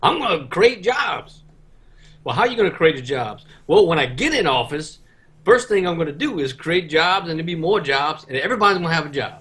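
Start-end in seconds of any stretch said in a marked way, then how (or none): 0.0-1.4 high, slow Southern drawl for a lying politician; mock Southern politician, stretched out slow; southern politician accent. Mocking politicians who dodge questions, in a high slow Southern accent. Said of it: "I love the way your accent, like, rolled with that, like, impression". 3.7-9.3 put-on southern politician accent. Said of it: "I love the way your accent, like, rolled with that, like, impression".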